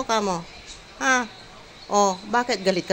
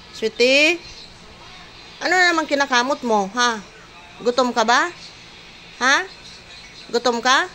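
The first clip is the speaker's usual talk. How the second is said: higher, sing-song coaxing voice throughout; 5 semitones higher than usual. Real, not acted. Caregiver cooing at elderly Sweetie, higher sing-song baby talk.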